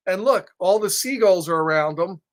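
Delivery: ordinary delivery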